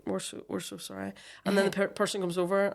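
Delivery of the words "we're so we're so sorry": softer apology. Retold or imitated. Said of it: "they're like".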